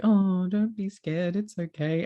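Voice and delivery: high, slow comforting coo; gentle baby-talk reassurance; soothing a scared dog. Retold or imitated. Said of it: "because I'm like".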